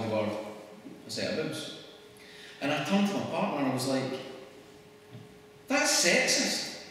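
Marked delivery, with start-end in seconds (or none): 5.7-6.8 high-pitched, drawn-out retold realisation; mock indignant discovery. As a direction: announce it slowly and high, like big news. Retells own reaction to partner.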